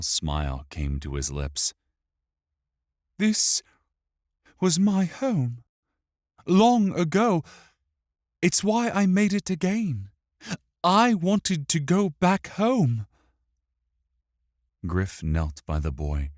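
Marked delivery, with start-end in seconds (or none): 3.2-13.1 put-on high child's voice, halting phrases; wistful boy with long pauses; homesick boy. A narrator's reading.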